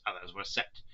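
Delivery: plain reading voice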